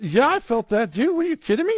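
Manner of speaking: high-pitched, indignant disbelief; incredulous. Retold or imitated. Shocked patient answering the doctor's obvious question, incredulous. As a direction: squawk it back in exaggerated disbelief.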